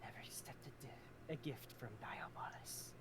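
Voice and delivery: breathy hissed whisper. Acted. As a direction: hiss it out under your breath. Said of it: "she hisses".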